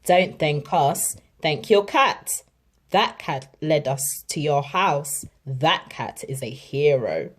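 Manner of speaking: gruff praise for the cat; fireman. A narrator's reading. Fireman telling the family their cat is a hero. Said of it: "The fireman said".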